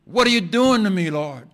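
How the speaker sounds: grieving son rails at God over his mother; fussing at God. Retold or imitated. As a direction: hurl the question at God in anguish. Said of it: "I fussed at God".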